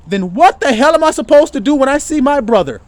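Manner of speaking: loud high preaching shout; fiery shout. Real, not acted. Preacher shouts to the congregation about his brother. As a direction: hammer it home.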